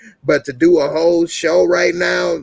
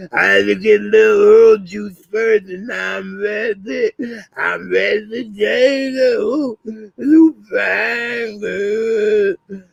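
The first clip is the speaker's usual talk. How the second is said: mock Ronnie; 8 semitones higher, slower than usual. Streamer mocking Ronnie chasing Jada, hammed-up high voice. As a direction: ham it up as eager Ronnie. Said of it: "Ronnie was crazy as hell".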